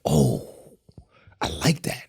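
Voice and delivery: awed hush. Retold or imitated. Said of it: "I was like".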